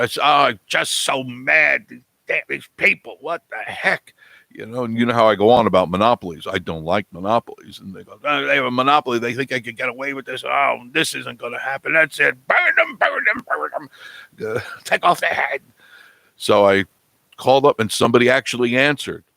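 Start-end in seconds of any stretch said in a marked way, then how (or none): 0.2-4.1 mock-mad venting. Host retells venting to Marie at midnight, hammed-up anger. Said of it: "I was so mad". 8.2-12.4 mock outraged rant. Acted rant about monopolies. 12.5-15.6 very high, comic vengeful chant; hammed-up call for execution, breaking into laughter; mock angry mob. Host jokes about punishing monopolies, mock mob cry.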